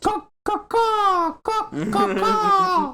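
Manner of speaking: very high cawing bird; owlbear voice. Pretend. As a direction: caw like a crow, high up. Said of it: "what do you think an owlbear sounds like".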